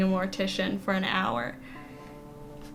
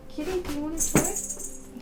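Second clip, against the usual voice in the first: talking to a cat; 6 semitones higher than usual. Real, not acted.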